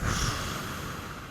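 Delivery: soft unvoiced straining breath out; mock straining sigh; long relieving exhale. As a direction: imitate the old man's breath at the urinal. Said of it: "you do the breath".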